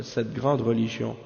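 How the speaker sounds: usual speaking voice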